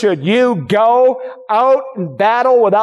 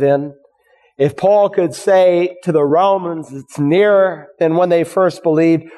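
lower-pitched, earnest pastoral prayer; closing prayer; 4 semitones lower than usual. Real, not acted. Preacher asking God to help the congregation, closing prayer. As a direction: bring the voice down and pray to God.

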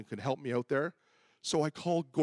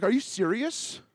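high, loud disbelief; can't believe he's being left alone; incredulous; 9 semitones higher, 6 dB louder than usual. Retold or imitated. Pastor realizing he'll be alone in the woods, shocked.

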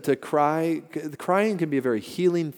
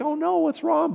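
much higher mock alarm; a parent's high startled worry; worried parent. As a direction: play the fretting parent. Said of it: "you're like".

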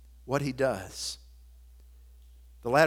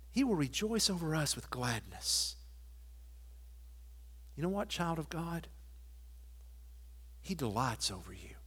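much softer, intimate sermon voice; tender pastoral hush; 10 dB quieter than usual. Acted. Pastor telling a child of God they are loved, softly. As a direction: lean in and speak it gently to each listener.

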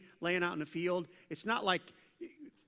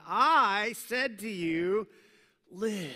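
God's voice; 4 semitones higher, 7 dB louder, much slower than usual. Acted. God speaking in Ezekiel reading.

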